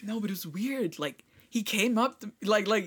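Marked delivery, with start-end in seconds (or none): none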